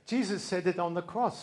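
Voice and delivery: ordinary delivery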